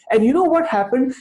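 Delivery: ordinary delivery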